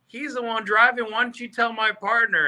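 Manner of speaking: high-pitched, defiant comeback; retold challenge. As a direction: act out daring the heckler, pitched well up.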